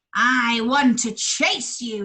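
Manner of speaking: mild bull roar. Acted. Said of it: "he roared".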